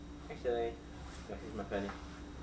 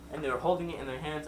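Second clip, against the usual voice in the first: ordinary delivery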